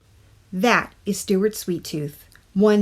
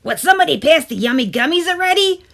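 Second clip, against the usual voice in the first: higher, louder, fast greedy tooth; impatient tooth voice; 9 semitones higher, 7 dB louder, faster than usual. A narrator's reading. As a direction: whine for candy like a spoiled little creature. Tooth demands candy at movies.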